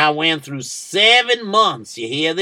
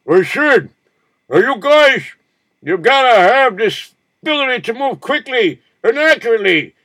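high, loud drill sergeant shout; harsh barking commands; 9 semitones higher, 5 dB louder than usual. Retold or imitated. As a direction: yell orders at the men. Officer yelling orders in suits.

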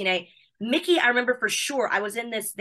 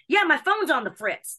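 slow, lightly Southern drawl imitating Mickey; a hint of Mickey's Southern accent on the fritz; mock Southern twang, high and unhurried; 7 semitones higher, 4 dB louder than usual. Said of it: "That's such a Southern phrase".

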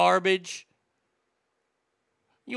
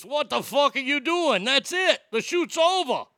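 higher-pitched mock scream, aggressive Brooklyn; aggressive Brooklyn yell; 7 semitones higher than usual. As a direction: imitate an angry Brooklynite calling a halt. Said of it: "screamed in my very aggressive Brooklyn voice".

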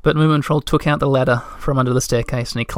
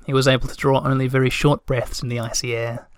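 ordinary delivery, nothing marked

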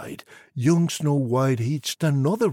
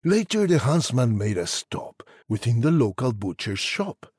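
ordinary delivery

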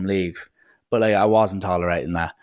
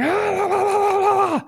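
high, laughing la la la; mocking the police. Neighbours and police banging at the door, mocked as high laughing gibberish. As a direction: babble it high like muffled voices through a door. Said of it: "being like".

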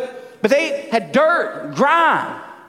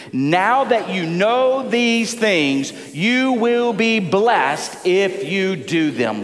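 slow, measured group reading; reading aloud together; 3 semitones lower, much slower than usual. Real, not acted. Pastor leading the congregation in a verse, slow and measured. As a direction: lead the room word by word, slowly. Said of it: "read this out loud with me".

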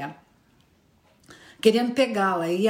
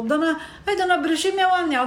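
put-on higher, small girl's plea; pleading girl; 6 semitones higher than usual. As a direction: ask the witch, pleading and high. (menina pedindo, voz aguda)

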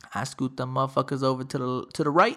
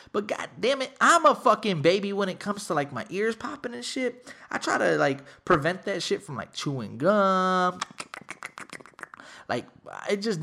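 usual speaking voice

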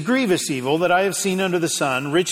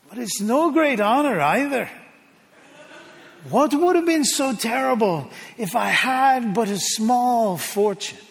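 higher, pleading voice of a poor farmer; Tevier praying to God; 4 semitones higher than usual. Retold or imitated. Poor farmer complaining to God in his barn, higher pleading voice. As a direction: lift the pitch and plead with the Lord, half joking. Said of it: "He looks up at God and he says".

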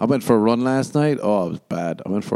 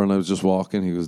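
ordinary delivery

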